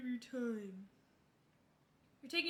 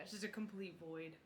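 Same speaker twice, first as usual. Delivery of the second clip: ordinary talk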